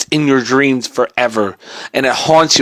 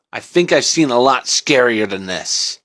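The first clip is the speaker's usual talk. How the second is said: ordinary delivery, nothing marked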